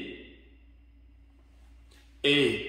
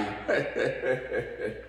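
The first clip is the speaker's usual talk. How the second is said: chuckle; 4 semitones lower, 5 dB quieter than usual. Real, not acted. Laughs at own slip.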